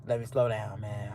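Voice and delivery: low, soft note to self; subdued, reining it in; slowing down. Real, not acted. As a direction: settle yourself down quietly.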